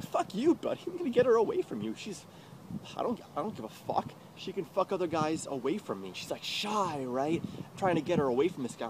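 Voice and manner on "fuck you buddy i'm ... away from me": high-pitched, fast angry rant; retold angry outburst. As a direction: spit it out at the guy, high and quick. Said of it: "I'm like".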